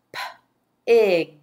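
"P-ig" broken into a lone P sound, then ig; teacher segmenting "P-ig" into its sounds. Shown as a demonstration. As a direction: tap out the sounds of "P-ig" with a gap.

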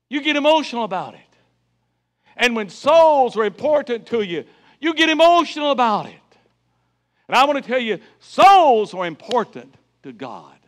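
high, loud fiery preaching; preaching at full force. Acted. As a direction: drive it home to the congregation. Preaching climax.

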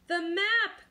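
high, loud pirate cry; alarmed cry. A narrator's reading. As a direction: cry out for the lost map. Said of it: "cried Ba-, Braidbeard".

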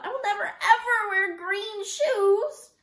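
high, loud, slow tearful protest; wailing. A narrator's reading. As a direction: wail it out, stretching each word. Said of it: "Kirsty wailed".

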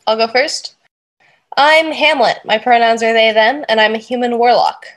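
ordinary talk, nothing marked

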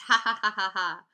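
loud, acted child's laugh; hammed-up ha-ha laugh. A narrator's reading. Fudge is delighted with himself, laughing. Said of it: "Fudge laughed".

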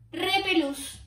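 exclamación alta y fuerte, Repelús; grito final. A narrator's reading. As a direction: soltar Repelús como remate triunfal. Remate exclamado de la princesa. (la princesa se despide de sus padres, gritando)

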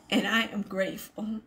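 usual speaking voice

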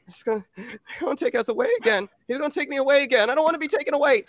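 put-on very high, racing child voice; hammed-up frightened kid, building as it goes; panicking little boy. Six-year-old boy fearing he'll be taken away, high panicked rush. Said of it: "he just starts freaking out".

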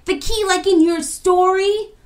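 very high, loud disbelief; astonished question. A narrator's reading. Equia linking key to Kofi's story, astonished question. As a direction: exclaim it in amazement.

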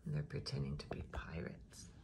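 soft whispered aside. Real, not acted. Reader whispers aside to the child listener. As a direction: lean in and whisper it.

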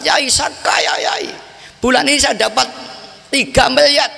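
high-pitched, elated announcement; retold excitement. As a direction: imitate someone bursting with news of riches. Retold phone boast of sudden riches. (menirukan orang kegirangan)

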